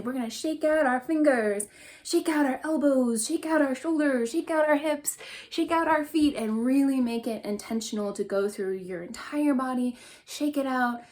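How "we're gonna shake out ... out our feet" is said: playful build-up. Shown as a demonstration.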